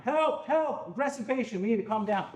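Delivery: high, urgent call for help, a touch of strain. Shown as a demonstration. Staff member calls colleagues for help, high urgent shout. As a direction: shout for backup across the room. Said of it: "while I'm yelling for help".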